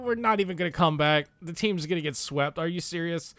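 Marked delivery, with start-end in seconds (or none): 2.6-3.3 quiet, stunned disbelief; muttered disbelief. Real, not acted. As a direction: say it under your breath in shock. Swept by Canucks.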